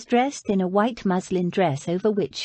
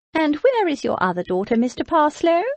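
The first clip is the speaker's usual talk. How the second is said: high, louder aristocratic query; pointed Lady Beatrice; 9 semitones higher, 4 dB louder than usual. A narrator's reading. Lady Beatrice to Mister Parslow, a high, pointed aristocratic question. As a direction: probe him with a raised, lofty voice.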